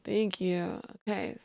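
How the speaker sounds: ordinary talk, nothing marked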